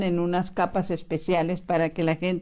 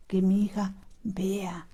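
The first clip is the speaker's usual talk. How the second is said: slow, whispered prayer; heartfelt whisper; 3 dB quieter than usual. Shown as a demonstration. Parent praying for a child's sight, whispered. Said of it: "pero con el corazón".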